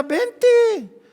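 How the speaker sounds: high, loud beckoning call. Acted. Jesus calling Peter to walk on water, loud high call. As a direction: summon someone from across the water.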